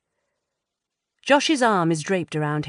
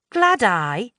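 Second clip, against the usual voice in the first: scoffing; 5 semitones higher, 5 dB louder than usual. Acted. Scoffing at old phrase.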